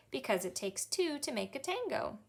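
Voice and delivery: plain reading voice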